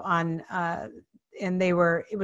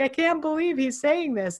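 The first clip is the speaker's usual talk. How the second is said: higher-pitched mock incredulity; retold disbelief; 8 semitones higher than usual. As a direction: imitate a stunned onlooker. Onlookers react to the challenge.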